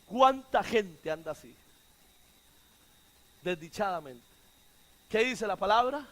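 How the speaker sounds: usual speaking voice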